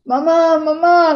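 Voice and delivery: loud high repeated shout; brother shouting. Retold or imitated. Brother shouting for mom across the field, loud high shout. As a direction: call mom twice, alarmed. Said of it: "grita mi hermano".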